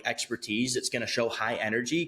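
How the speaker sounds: usual speaking voice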